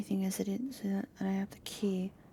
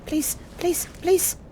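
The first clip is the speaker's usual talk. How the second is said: high, rising begging please; high pleading; 10 semitones higher, 6 dB louder, slower than usual. Real, not acted. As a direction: beg louder each time. Begging the gap to open.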